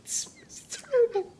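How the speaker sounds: high squeaky voice through laughter; can barely speak for laughing; laughing hard. Real, not acted.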